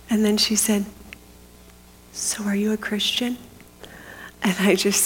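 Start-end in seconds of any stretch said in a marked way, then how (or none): 2.1-3.4 hushed, secret answer; whispered reply. Retold or imitated. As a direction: keep it under your breath. Secret talk about the Bible.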